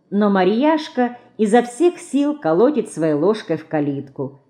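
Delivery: plain reading voice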